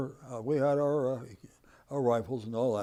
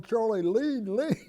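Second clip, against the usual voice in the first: high, raised voice breaking into laughter; hollering, retold; 10 semitones higher, 6 dB louder, slower than usual. Veteran mimics the chief in the tipping Jeep, hollering with amusement. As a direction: imitate an urgent holler, amused. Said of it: "the chief was hollering".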